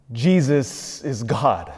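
slow, weighty declaration; slow emphatic. Real, not acted. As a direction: land each word slowly. Doctrinal climax, slow.

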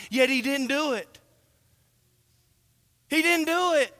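fervent pulpit build, from insistent to ringing; building sermon climax. Acted. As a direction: preach it up to full force and hammer the last line.

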